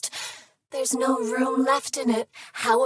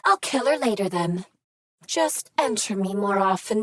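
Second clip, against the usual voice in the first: normal talking voice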